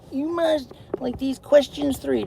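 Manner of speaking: high, loud hammed-up guardian voice; mock bridge keeper. Doing the Monty Python bridge keeper, high and loud. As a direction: ham up the bridge keeper challenge.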